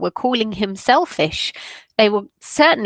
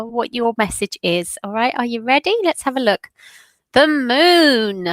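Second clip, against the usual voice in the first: ordinary talk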